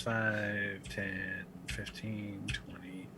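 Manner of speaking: low, very soft self-talk; counting to self. Real, not acted. Player doing some math real fast, quietly counting to self.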